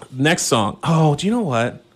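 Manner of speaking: ordinary delivery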